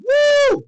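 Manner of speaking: high, loud "woo"; thrilled whoop. Real, not acted. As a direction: cheer out loud in one high note. Cheering after the round.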